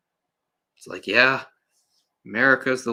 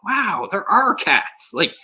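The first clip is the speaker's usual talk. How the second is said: sarcastic mock wonder; 8 semitones higher, 7 dB louder than usual. Reviewer mocks Fievel's delight, much higher and sarcastic. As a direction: gush over the cats with heavy sarcasm. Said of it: "And so he's like".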